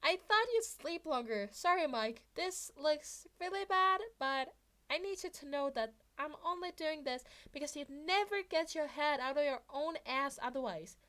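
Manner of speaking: put-on bright-eyed kid caught in the act; Midoriya voice. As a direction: play the apologetic teen boy. Midoriya voiced in fanfic reading.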